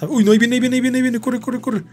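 high, breakneck repeated alarm; panicked. Real, not acted. Gamer fleeing as Calamardo comes, panicked. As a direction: blurt it high, urging yourself to run. Enemy coming, telling self to run.

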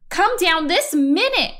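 big brother scolds, firm; stern order. Acted. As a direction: order her down at once. Fox orders sister down.

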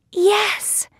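very high little heroine voice; Thumbelina. A narrator's reading. Accepting the prince's proposal, very high heroine voice. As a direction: jump right up high for the answer.

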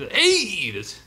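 mock announcer opener, high then dropping; imitated announcer voice. Co-host teasing the host's intro, imitated announcer voice. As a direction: clench the throat for a high mock "hey". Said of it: "your throat had to just clench up".

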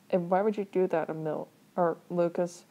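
plain reading voice

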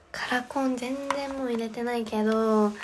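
ordinary talk, nothing marked

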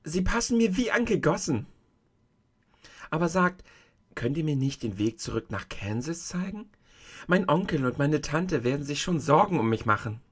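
a hint of a confused young girl; Dorothy, puzzled girl. Dorothy protests to the old woman, lightly voiced, unsure.

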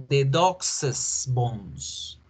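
very slow, "dog's" as /dokses/; plural possessive. Shown as a demonstration. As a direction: drag out "dog's bones" with the extra es. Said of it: "esta apóstrofe se debe pronunciar es".

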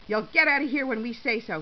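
loud, curt retort; snapped. A narrator's reading. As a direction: shut down the detainee's question. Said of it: "he snapped".

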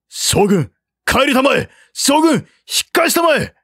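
far-off desperate cry; calling out. A narrator's reading. Soldiers begging the general to turn back, desperate calling. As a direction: call him back urgently. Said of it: "と呼んでいたが".

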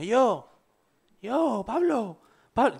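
high, slow calling out for Pablo. Retold or imitated. Friend calls through locked door for Pablo, high and drawn out. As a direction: call his name through the locked door.

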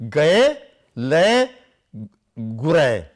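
Gaye, le, gure, each vowel sounded out; careful Punjabi scripture pronunciation; recited vowel pattern. Shown as a demonstration. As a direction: chant gaye le gure clearly. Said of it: "same pattern".

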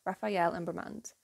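normal talking voice

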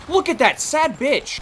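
slow, high taunting; mocking. Real, not acted. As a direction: sneer at the loser. Mocking a dead enemy.

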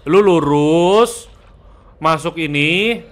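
very slow, drawn-out directions; slow guiding. Real, not acted. Player guides teammate straight into the room, slow guiding. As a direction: stretch out each step of the instruction.

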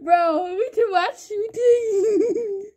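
crying with laughter, voice squeaking high; words breaking up in helpless laughter; laughing. Real, not acted. Laughing at his friend over voice chat, words cracking.

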